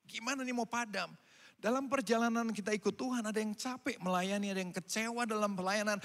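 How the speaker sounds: ordinary talk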